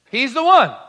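preaching shout. Acted. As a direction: lift it and proclaim it. Preacher's climax line.

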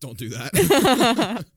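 loud, drawn-out high cry of don't; mock warning shout. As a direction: shout it slow like pleading with students. Warning film students, mock plea.

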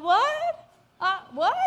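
high, loud disbelieving question; astonished. Retold or imitated. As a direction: squeal it in mock disbelief. Astonished at six hundred dollars offer.

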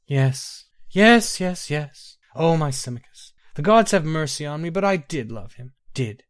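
high, breaking admission; tearful confession. Acted. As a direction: confess through grief.

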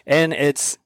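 ordinary delivery

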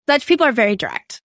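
ordinary talk

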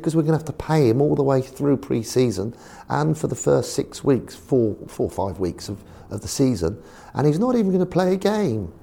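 high posh complaining; a lightly voiced posh chairman fussing. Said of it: "He's very posh".

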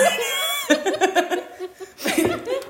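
shrieking laugh; high laugh. Real, not acted. Big laugh.

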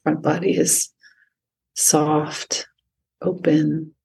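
calm, unhurried meditation voice with long pauses; guided meditation. Acted. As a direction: guide the listener gently into stillness. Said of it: "lead us through any sort of meditation".

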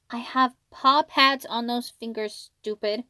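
slow, loud and indignant, then ease off; yelled. A narrator's reading. Hiro yells at his brother, an indignant yell. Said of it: "Hiro yelled".

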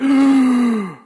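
long, loud, high intake of breath; big mock gasp of a child's amazement; awed gasp. Daughter spotting the huge roller coaster, awed gasp.

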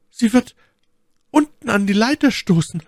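high-pitched cry of alarm; alarmed Milady. A narrator's reading. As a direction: voice the lady's panic, pitched far up. Milady fears the patrol. (erschrockene, hohe Frauenstimme)

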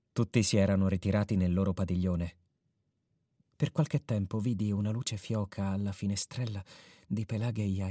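soft, low storytelling voice; story narration. A narrator's reading. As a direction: narrate quietly as if at night.

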